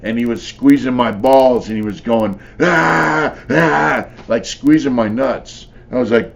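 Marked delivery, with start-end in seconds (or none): 2.6-4.1 high, loud straining ah ah; mock strained grunts. Imitating a fighter squeezing his opponent, high strained grunts. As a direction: imitate the guy's effort noises while squeezing.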